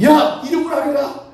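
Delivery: sudden high-pitched order; acted command. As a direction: call out the order sharply. Acts out Elisha's sudden order. (갑자기 높은 소리로 명령하듯)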